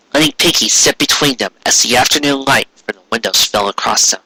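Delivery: ordinary delivery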